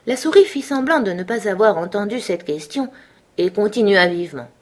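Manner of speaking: ordinary delivery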